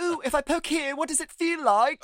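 put-on doctor probing a brain in a hammy French Canadian accent; mock French Canadian doctor. Retelling the heritage minute, a mock French Canadian doctor. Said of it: "that's not a Canadian accent".

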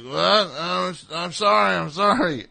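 scared apology. Retold or imitated. Drunk stranger apologising to the screaming wife, flustered. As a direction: blurt sorry in a panic.

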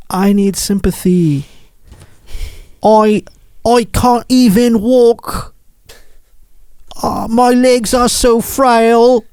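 slow, high, feeble fake British accent; mocking a man feigning illness in a cheap British accent; mock frail British accent. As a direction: whine like a faker in a bad British accent. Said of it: "sporting a dollar store British accent".

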